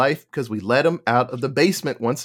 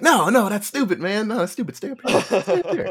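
high, pleading, half laughing; 6 semitones higher than usual. Retold or imitated. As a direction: beg him to stay upstairs. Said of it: "You're like".

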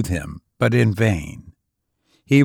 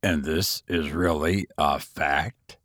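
1 lower gentleman; 7 semitones lower, 7 dB quieter than usual. A narrator's reading. Inquisitive gentleman questions.

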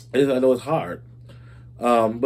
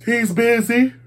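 much higher, squealed-up quote; high-pitched exclamation; 10 semitones higher, slower than usual. Retold or imitated. Host quoting Wendy about her date, high-pitched. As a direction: pitch it way up, rising at the end.

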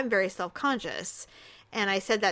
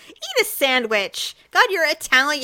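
mock scolding; 5 semitones higher, 7 dB louder than usual. Scolding absent celebrity Juliana.

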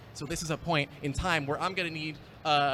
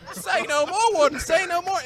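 high, louder, eager put-on urchin voice; a grinning accomplice who can't wait to loot; sly orphan voice; 14 semitones higher, 8 dB louder than usual. Groundskeeper eagerly agreeing with the warden in a high voice. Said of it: "really bad accent".

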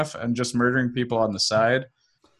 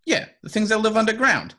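high-pitched informant impression; Willy; 9 semitones higher than usual. Informant explaining the underground, higher put-on voice. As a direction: push the character voice up high. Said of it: "Excellent Willy impression".

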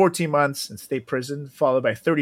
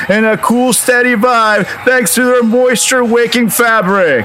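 punchy sponsor read, louder and upbeat; 7 semitones higher, 9 dB louder than usual. Acted. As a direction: sell it like a football pregame hype. Ad read as football lineup.

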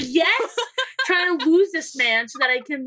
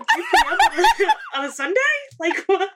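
squeaky, high, laughing incredulity; mock disbelief; 13 semitones higher, 5 dB louder than usual. Daughter mocking dad's early bar trip, high laughing disbelief. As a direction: laugh it out in fake shock.

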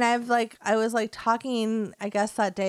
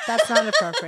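scolding mom; 8 semitones higher, 7 dB louder than usual. Retold or imitated. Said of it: "or I'll be like".

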